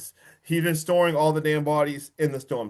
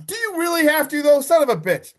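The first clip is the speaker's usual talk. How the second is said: real outrage jumping high and louder; exasperated outburst; 11 semitones higher, 5 dB louder, faster than usual. Real, not acted. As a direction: cry out in disbelief at the screen. Outraged reaction to killer twist.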